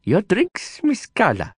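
high, polite servant voice; servant's formal voice. Acted. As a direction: announce the drinks deferentially. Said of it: "Rajan said".

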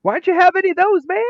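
hammed-up frantic Joe Dirt; excited rant. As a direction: rattle off each firework, outraged. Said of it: "And Joe Dirt goes".

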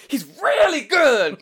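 high, slow, very loud proclamation; hammed-up pulpit holler; escalating preacher shout. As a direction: roar it like a worked-up preacher.

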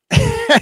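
high, sudden laugh; short high laugh; burst of laughter. Real, not acted. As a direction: let a real laugh break out. Laugh after the quote.

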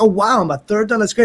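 lively, pleased exclamation; super happy. Retold or imitated. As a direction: act out being thrilled at the news. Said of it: "I was super happy".

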